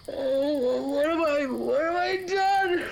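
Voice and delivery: high, tearful panic with sobs; sobbing over a dead husband; panicked crying. Acted. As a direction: wail it, voice breaking in fear. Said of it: "stop crying, you baby".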